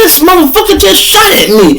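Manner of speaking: high, loud laughing shout. Retold or imitated. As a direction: yell it while cracking up. Friend laughing in shock.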